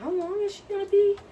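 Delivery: lightly voiced impatient patient. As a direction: whine like a fed-up patient at the desk. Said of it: "walk up to the desk and say".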